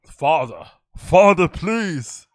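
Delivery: mock begging, laughing through it; big boy voice. Mocking a comedian pleading with his father, laughing. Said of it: "he puts his big boy voice on".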